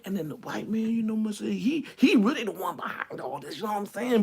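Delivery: soft, guarded mock confiding; voice goes down. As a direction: drop the volume as if afraid of being overheard. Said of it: "their voice will go down".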